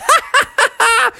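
high, gleeful cackle; acted laughter. Both friends delighted by the egg mess, laughing. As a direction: burst into high staccato laughter. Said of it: "and they were both".

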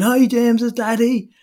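put-on high, bright-eyed greeting to a toddler; sing-song creche worker. Creche staff greeting a child at pickup, sing-song high.

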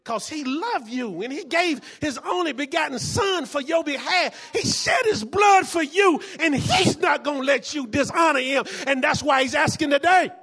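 building, loud preacher's cry; preaching. Acted. Preacher building to a shout at the congregation. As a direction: preach it with rising force.

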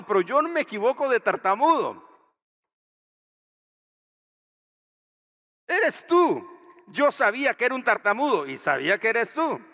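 high, loud, booming divine insistence; God answering Moses. Acted. God insisting to stuttering Moses, high and loud. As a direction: thunder it out as God. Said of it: "Dios dice".

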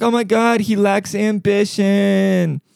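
high, slow whiny wife voice; mocking housewife. Mocking a wife complaining about her husband, high and whiny. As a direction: whine it like a neglected wife. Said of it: "you're like".

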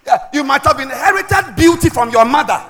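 loud, emphatic preacher delivery; forceful preaching. Acted. Preacher teaching on Elisha's two fathers, forceful preaching. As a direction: drive each phrase home to the congregation.

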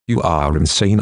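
ordinary delivery, nothing marked

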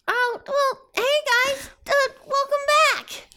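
very high little rabbit voice; tiny creature voice. Acted. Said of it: "you hear a little voice say".

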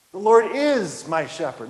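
IS punched and held, slow line; stress on IS. Shown as a demonstration. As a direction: lean hard on the word is. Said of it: "Emphasize every word of just that first line".

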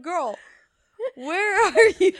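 high, loud mock-incredulous call, breaking into laughter; mock disbelief. Friend teasing her over a picked-up accent, mock disbelief.

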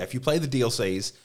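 ordinary talk, nothing marked

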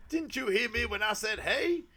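high-pitched, over-eager comic, rising to a loud HEY; over-enthusiastic. Retold or imitated. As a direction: imitate an over-keen stage act. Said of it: "doing all this really over-enthusiastic".

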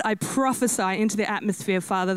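usual speaking voice

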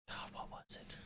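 whispered aside to himself; soft whispered search for a word; whisper to self. Real, not acted. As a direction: breathe it out while thinking. Muttering to himself, trying to remember.

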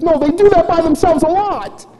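put-on very high child's squeal; hammed-up high kid voice, insisting; Chloe. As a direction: imitate a little girl's earnest denial. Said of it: "She says".

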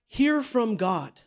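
"hear from God" slowed right down; slow emphasis. Shown as a demonstration. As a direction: lean on each word of "hear from God", unhurried. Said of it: "That should be your task".

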